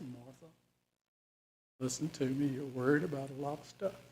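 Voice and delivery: soft, very quiet calm rebuke. Acted. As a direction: soothe her without harshness. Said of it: "he would have said that calmly".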